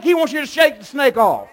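loud, high preacher's shout; shouted preaching. Acted. Preacher shouting to the church to shake it off, loud. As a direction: hit the climax of the sermon.

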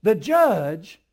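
loud, high, pounding sermon climax; full-force preaching. Acted. Preacher warning the church the judge is at the door, full force. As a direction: thunder it out, each line a hammer.

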